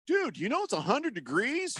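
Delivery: high-pitched disbelief; incredulous. Retold or imitated. Business contact to a man sitting in the sun, incredulous. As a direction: act out someone baffled, voice jumping up. Acting out a baffled acquaintance.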